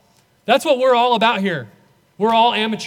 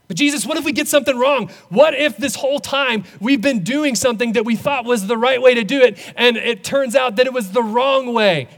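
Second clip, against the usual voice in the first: higher, louder and fast, worried questions tumbling out; pleading doubter; 4 semitones higher, 4 dB louder than usual. Acted. A worried believer pleads with Jesus, higher and urgent. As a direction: press Jesus with anxious what-ifs.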